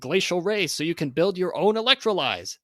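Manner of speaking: loud, high hyped sales pitch; carnival barker. Acted. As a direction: hawk it like a sideshow barker. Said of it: "read this in sort of a carnival barker type voice".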